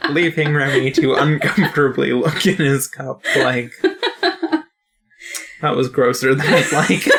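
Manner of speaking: real giggles breaking into the storytelling; amused, laughing as you go; laughing narration. Real, not acted. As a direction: narrate through your laughter. Cracking up while narrating the scene.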